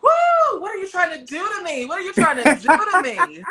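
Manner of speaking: high, whooping, flustered exclamation; overwhelmed outburst. Real, not acted. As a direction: cry out as if the praise is too much. Overwhelmed by heartfelt praise, cries out.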